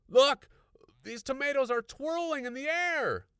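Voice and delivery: high, loud urgent pointing shout; excited cry. A narrator's reading. Husband showing his wife the tomatoes, excited cry. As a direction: make her look, astonished. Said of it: "Mister Evander cried".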